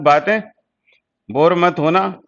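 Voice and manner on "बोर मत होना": usual speaking voice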